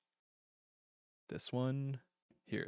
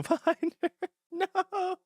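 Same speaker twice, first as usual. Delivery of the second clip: squeaky no; 5 dB louder, slower than usual. Acted. Mock protest about the priest.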